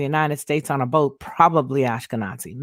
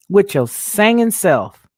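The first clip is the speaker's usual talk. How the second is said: "singing" stressed, higher, louder and slow; playful emphatic repeat; 5 semitones higher, 4 dB louder, slower than usual. Real, not acted. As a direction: lean into "singing" louder and slower with delight.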